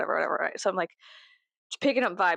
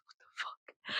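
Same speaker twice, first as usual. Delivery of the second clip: whispered disbelief; 16 dB quieter, slower than usual. Real, not acted.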